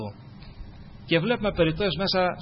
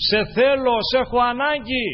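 loud, high plea; heartfelt cry; 6 semitones higher, 4 dB louder than usual. Acted. Preacher voicing heartfelt prayer cry.

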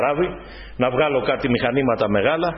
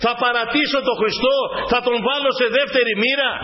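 raised, insistent sermon voice; preaching; 7 semitones higher than usual. Acted. Preacher urging the brothers and sisters not to lose their goal, fervent preaching. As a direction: preach it to the faithful with fervour.

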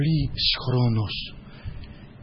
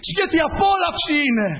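joyful exclamation; 13 semitones higher than usual. Acted. Preacher exclaiming joy of God.

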